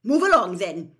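loud, brisk teacher's order; sharp, no-nonsense command. A narrator's reading. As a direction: herd the crowd along sharply. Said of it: "said Professor McGonagall sharply".

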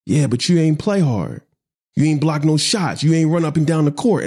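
upset dad. Retold or imitated. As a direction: replay a father's frustrated lecture. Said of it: "And I'm getting upset".

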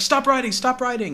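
high, loud mock panic; imitated freak-out. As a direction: act out a frantic professor losing it. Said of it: "as he's freaking out".

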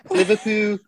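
high, drawn-out Scouse place-name; mock Liverpool accent. Mocking people who only name the place, Liverpool accent. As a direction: exaggerate a Scouse accent, slowly. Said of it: "say the place in that accent".

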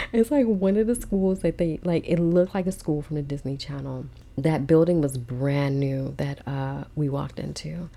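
normal talking voice

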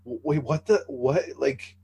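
imitating stunned guests, rising; baffled "what just happened"; confused guests. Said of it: "the shock on their face".

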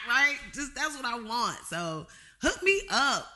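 normal talking voice

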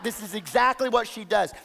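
normal talking voice

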